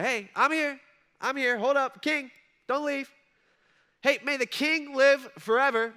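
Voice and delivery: high, loud, eager shout from below; eager calling up. Retold or imitated. Daniel calling up to the departing king, high eager shout. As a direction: call up to stop him leaving. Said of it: "He's like".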